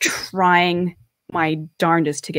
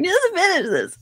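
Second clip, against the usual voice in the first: retold crying; 12 semitones higher than usual. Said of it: "crying my eyes out doing this".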